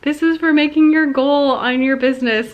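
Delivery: husband's proud words. Retold or imitated. Husband giving her the purse for reaching her business goal, proud and loud. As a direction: present it like a gift reveal. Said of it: "he was like".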